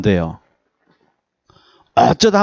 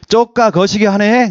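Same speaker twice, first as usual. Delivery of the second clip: slow, laughing Jeolla "쪼까 거시기 하네"; Jeolla dialect; 5 semitones higher than usual. Shown as a demonstration. Pastor imitating Jeolla speech for the congregation, slow and laughing. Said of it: "전라도는".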